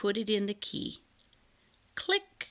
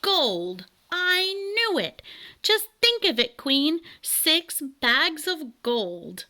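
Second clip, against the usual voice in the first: much higher, loud, slow excited trill; 8 semitones higher, 5 dB louder, slower than usual. Acted. Bird king trilling to the queen about gold. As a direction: thrill over the gold in a high sing-song. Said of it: "trilled the king".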